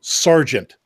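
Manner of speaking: ordinary delivery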